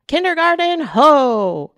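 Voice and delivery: loud high "land ho" call; excited pirate shout. Acted. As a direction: call it out like a lookout. Pirate Emma sights school.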